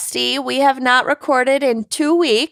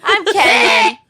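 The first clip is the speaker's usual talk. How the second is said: excited shout; 9 semitones higher than usual. Acted. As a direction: shout your name with a laugh. Excited shouted self-introduction.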